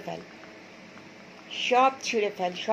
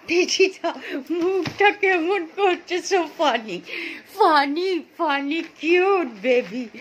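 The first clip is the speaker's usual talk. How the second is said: put-on higher, cooing baby talk to the dog; baby talk to pet; 6 semitones higher, 4 dB louder, slower than usual. Owner coos at her dog's funny face in higher baby talk. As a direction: coax the puppy in sing-song baby talk.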